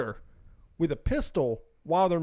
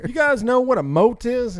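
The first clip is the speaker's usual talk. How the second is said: higher and louder, eager boss voice; mock Trump; 7 semitones higher, 7 dB louder than usual. Imitating Trump pitching his idea to staff, hammed-up. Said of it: "He's like".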